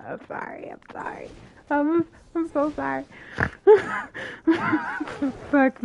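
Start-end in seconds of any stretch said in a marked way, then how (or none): 0.1-0.7 breathy whisper, barely voiced; whispered apology. Real, not acted. After fire mistake. 0.7-1.3 soft voiceless whisper; whispered apology. Real, not acted. After fire mistake.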